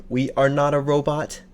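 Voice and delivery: ordinary talk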